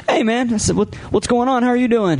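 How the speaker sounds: high, bright retold hello; eager greeting. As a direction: greet him all pumped up. Retelling his own eager greeting.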